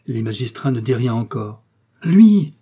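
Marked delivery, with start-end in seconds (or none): 2.0-2.5 sudden high, very loud cry. A narrator's reading. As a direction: cry it out at full voice. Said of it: "s'écria alors Funghetti". (s'écrier d'une voix forte et aiguë)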